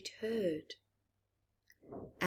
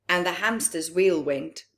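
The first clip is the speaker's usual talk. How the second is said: lilting storybook read-aloud; gentle bedtime-story telling; reading to children; 3 dB louder than usual. A narrator's reading. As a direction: read it to a room of little ones. Reads picture book aloud.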